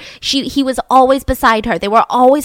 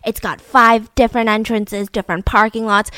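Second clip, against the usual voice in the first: usual speaking voice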